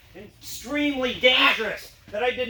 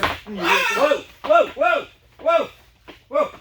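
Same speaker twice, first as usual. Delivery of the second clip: startled whoas; 3 semitones higher, 4 dB louder, much slower than usual. Real, not acted. Dog tips the board.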